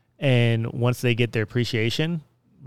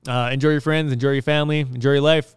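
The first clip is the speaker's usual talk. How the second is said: podcast sign-off; 4 semitones higher, 6 dB louder than usual. Acted. Signing off the podcast.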